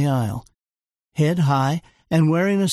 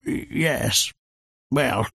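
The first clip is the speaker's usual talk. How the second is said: flustered Churchill; 4 semitones lower, slower than usual. A narrator's reading. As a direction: caught out, fumble the words. Churchill flustered under Joan's glare.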